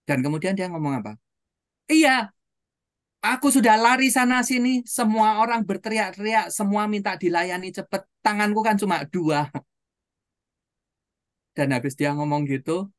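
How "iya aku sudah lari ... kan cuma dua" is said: high, loud retold rant of an overwhelmed waitress; waitress's upset outburst. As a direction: act out a worn-out waitress bursting out in protest. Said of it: "bibirnya grewelan, gemetaran". (luapan kesal pelayan, nada tinggi)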